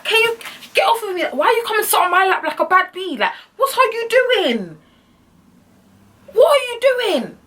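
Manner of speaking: very high squealed protest; hammed-up high-pitched disbelief; outraged protest. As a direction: squeal it, stretching the last questions. Fending off date's advances.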